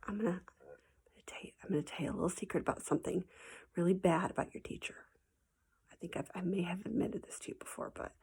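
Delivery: hushed whisper, sharing a naughty secret. Shown as a demonstration. Teacher to her students, confiding a secret in a whisper. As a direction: lean in and whisper it like a confession.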